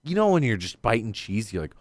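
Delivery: usual speaking voice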